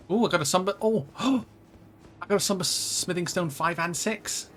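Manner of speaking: thrilled loot find; excited. Real, not acted.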